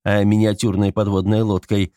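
usual speaking voice

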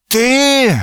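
high-pitched, drawn-out indignant "you"; angry outburst. A narrator's reading. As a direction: exclaim it high, in angry disbelief. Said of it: "прошипел он". (возмущённо, высоким голосом)

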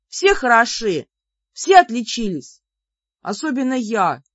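high, loud angry scolding; exasperated outburst. Acted. Heroine orders the bickering pair off her, exasperated. Fed-up heroine stops a quarrel.

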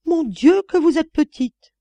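high, loud astonished exclamation; astonished cry. A narrator's reading. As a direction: gasp it out in shock at her size. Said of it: "Elle s'écria d'une voix étonnée".

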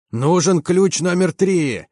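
high, drawn-out bellow, held back in volume; full-throated roar. A narrator's reading. Commander relays the call for a wrench, roaring. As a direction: call it out slowly like a roar across the hull. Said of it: "заревел я во всю глотку".

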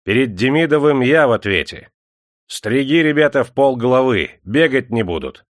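high, loud commanding call; shouted orders. A narrator's reading. Clerk orders the shearers to crop heads, shouting. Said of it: "кричал приказчик".

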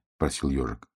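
usual speaking voice

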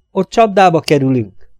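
urgent warning. A narrator's reading. Warning her away from lift.

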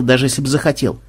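plain reading voice

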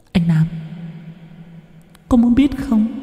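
high, soft, eerie woman's voice; a dead girl teasing him from nowhere; cold ghostly voice. A narrator's reading. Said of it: "giọng nói lạnh lùng". (giọng ma nữ lạnh lùng, cao và nhỏ)

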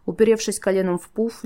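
ordinary delivery

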